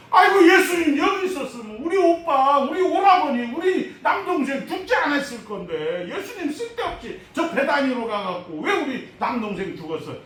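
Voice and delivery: high, loud, laughing mock lament; hammed-up grieving sister blaming Jesus; wailing sister. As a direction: sister scolds Jesus over her dead brother, high mock wail. Martha's lament voiced.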